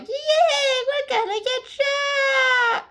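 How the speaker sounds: very high laughing mock cheer, dragged out slowly; giddy kids. Kids cheering about being shot, very high and laughing. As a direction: squeal and laugh it like clueless children. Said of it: "the kids are like".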